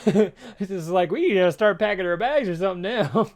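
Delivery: amused, laughing. Real, not acted. Co-host joking about fleeing the venue, laughing. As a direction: crack up while joking.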